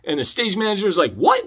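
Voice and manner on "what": high, rising incredulous what; startled disbelief. Retold or imitated. Stage manager hearing the show is delayed, startled. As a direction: react in high-pitched disbelief.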